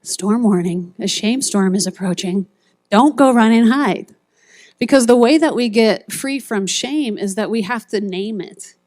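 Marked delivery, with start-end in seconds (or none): 0.0-4.1 loud mock buzzer warning, ending in a louder command; storm warning alarm. Speaker giving listeners the storm warning for their heads, loud. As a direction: sound the alarm, then urge them to stay. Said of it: "hear my voice in your head going".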